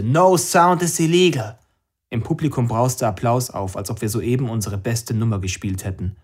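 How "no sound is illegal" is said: high, loud and slow bellow; triumphant shout to the crowd; roared slogan. Acted. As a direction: roar it into the microphone. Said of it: "und brüllte".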